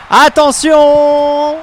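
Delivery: long stretched shouted attention; drawn-out warning. Acted. Commentator warning viewers: stretched shout. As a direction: stretch it out to build suspense.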